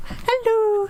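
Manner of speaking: very high sing-song hello; squeaky hello like greeting a dog. Retold or imitated. She greets the dog in a high squeaky hello.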